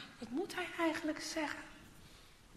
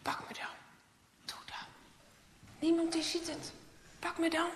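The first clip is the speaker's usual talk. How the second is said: lightly voiced tempting knives, hushed then higher; luring knives; 5 semitones higher than usual. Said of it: "die messen zeggen". (verleidelijk fluisterende messen)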